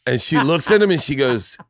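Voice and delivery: ordinary delivery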